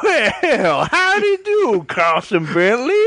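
put-on high, slow, laughing old prospector; cackling old gold prospector. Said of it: "Prospector Jimmy".

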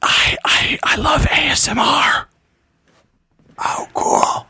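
whispered ASMR girl impression; breathy whispering pop star; [name] whisper. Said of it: "it just sounds like a".

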